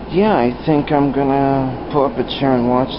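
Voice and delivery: normal talking voice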